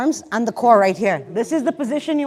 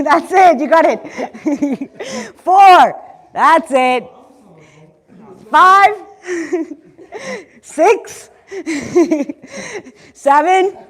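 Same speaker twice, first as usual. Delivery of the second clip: laughing while counting; 5 semitones higher, 8 dB louder, much slower than usual. Real, not acted.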